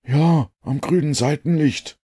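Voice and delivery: the inspector explains the boat light, high and slow; inspector's voice. A narrator's reading. Inspector answers Holmes's question.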